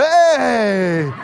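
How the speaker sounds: high, loud holler tailing downward; long rowdy "hey" from the stands; drunk fans' cheer. Retold or imitated. Tipsy fans a few rows back join in, rowdy cheer. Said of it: "had been drinking a few rows back".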